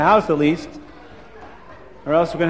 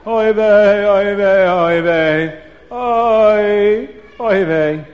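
man wailing; 3 semitones higher, 5 dB louder, much slower than usual. A narrator's reading. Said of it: "he hears a man's voice".

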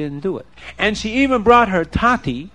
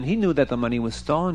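ordinary delivery

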